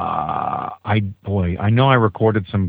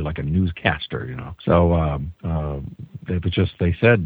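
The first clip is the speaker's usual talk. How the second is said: normal talking voice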